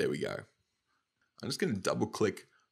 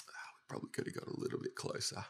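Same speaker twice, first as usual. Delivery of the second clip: near-whisper, very soft, barely voiced; muttered aside; 11 dB quieter than usual. Real, not acted. Tutor thinking aloud while zooming in, muttered aside. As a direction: mutter it under your breath to yourself.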